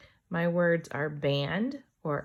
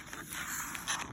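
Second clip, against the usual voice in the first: soft gasp; 12 dB quieter than usual. Acted.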